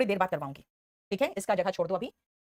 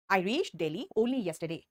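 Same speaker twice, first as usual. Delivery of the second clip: "I reached Delhi only yesterday" very slow and a bit quieter; slow, slightly softer reading; 3 semitones lower, 4 dB quieter, much slower than usual. Shown as a demonstration. Teacher repeating the sentence slowly for copying. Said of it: "Only इस yesterday को qualify".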